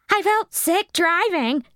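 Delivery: put-on high girlish voice, slow; queasy girl. The chunky girl explaining to the cops, high and slow. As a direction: let it drag a little.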